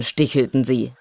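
plain reading voice

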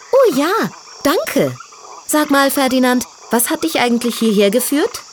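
put-on high, vain young piglet voice; girly piglet voice. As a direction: play a proud little diva pig. Tütü thanks him.

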